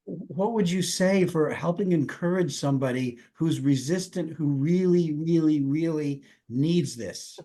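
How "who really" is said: normal talking voice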